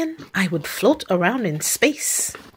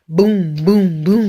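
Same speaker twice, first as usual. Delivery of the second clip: booming thunder; 3 dB louder, slower than usual. A narrator's reading. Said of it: "Mister Brown makes thunder".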